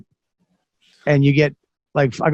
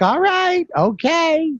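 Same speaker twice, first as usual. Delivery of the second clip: wife's voice; 13 semitones higher, slower than usual. Retold or imitated. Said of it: "She was like".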